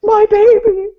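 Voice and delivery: very high, loud comic shriek; mock horrified scream. Off-screen parent in the chaos, mock horrified scream. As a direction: scream it like a hammed-up disaster victim.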